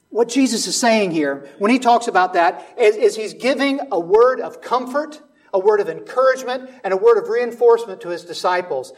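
loud, high preaching to the congregation; full-force preaching. Acted.

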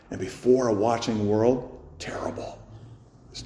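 a grave whispered aside to the congregation; hushed whisper. Real, not acted. Preacher drops to whisper.

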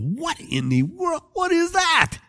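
high-pitched, drawn-out amazement; astonished disbelief. Acted. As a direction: squeak it like a stunned onlooker. David first sees the giant.

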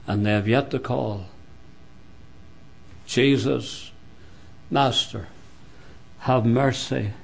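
low, hushed sermon with long pauses; solemn preaching. Real, not acted. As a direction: drop low and soft, warn the congregation gravely. Preacher drops to solemn warning.

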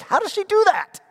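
put-on high, loud, disbelieving kid voice; child baffled by his mother's switch, squeaky disbelief; amazed. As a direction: gasp it out in shock. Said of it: "I'm like".

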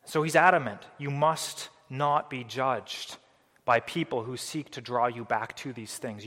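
ordinary talk

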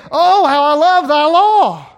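loud, high, slow exclamation of love; exclaimed with joy. Shown as a demonstration. Preacher showing the congregation the exclamation point, loud and joyful. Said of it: "And now I can say".

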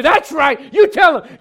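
loud, high hollered encouragement; rousing congregational shout. Acted. Preacher mimics congregation cheering that Jesus was hungry, exuberant shouting. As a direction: egg the preacher on at full volume.

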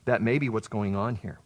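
ordinary delivery

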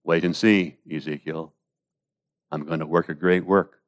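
hushed, patient promise; calm reassurance. Acted. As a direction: answer the protest quietly and surely. Acts out God's calm reply.

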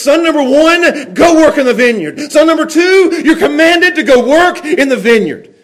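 high, forceful fatherly order; authoritative command. Acted. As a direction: command them with full authority. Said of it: "with all authority".

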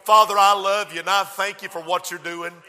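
ordinary delivery

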